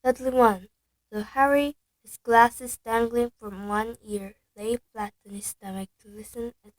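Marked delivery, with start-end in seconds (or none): none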